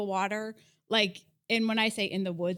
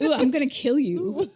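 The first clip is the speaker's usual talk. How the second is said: louder mock-spooky donkey threat; cartoony menace; 2 semitones higher, 5 dB louder than usual. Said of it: "be cartoony".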